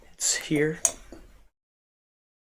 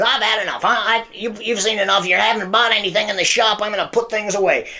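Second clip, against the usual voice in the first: high, hurried dismissal; high-pitched, impatient magician; fed-up shopkeeper; 7 semitones higher, 3 dB louder than usual. Acted. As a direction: shoo the customer off in the magician's voice. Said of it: "he says".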